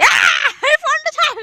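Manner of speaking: very high, squealed protest; high-pitched yelp. Real, not acted. Telling someone to let go of the phone, high squeal.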